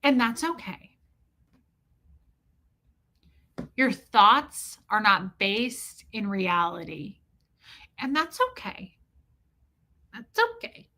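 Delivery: slow, sing-song patronizing calm; patronizing ex-husband. Retold or imitated. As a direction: talk down to her like a child. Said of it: "He'd go".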